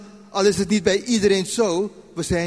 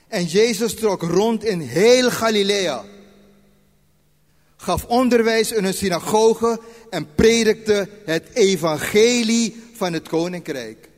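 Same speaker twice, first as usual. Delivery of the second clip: louder, slower Bible reading; scripture reading; 3 semitones higher, 4 dB louder than usual. Acted. Preacher reads Matthew aloud to the congregation, measured. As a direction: read the verses out, measured and louder.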